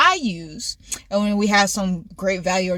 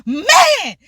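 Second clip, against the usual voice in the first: high-pitched, exasperated retelling; dramatized, worked-up complaint; retold frustrated rant; 13 semitones higher, slower than usual. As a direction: go in on a mock tirade, high. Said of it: "I'm just going in".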